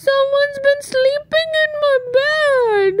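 put-on high, whiny baby bear cry; Baby Bear finding his bed used, high tearful wail. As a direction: wail like a tiny upset bear. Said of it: "cried Baby Bear".

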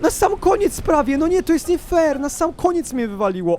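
high aggrieved complaint; whining protest. Real, not acted. Player knocked out at the very end, high whining protest. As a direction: whine upward, easing down as it goes on.

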